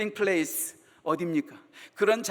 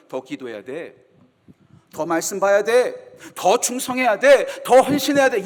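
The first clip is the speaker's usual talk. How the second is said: louder and slower, pressing demands; mock pushy advice; 7 dB louder than usual. Pastor mimicking pushy church advice to the weary, rising demands. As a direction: lecture a tired believer to do more. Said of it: "어떻게 권면합니까".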